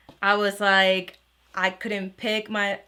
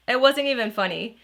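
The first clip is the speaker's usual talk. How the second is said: ordinary talk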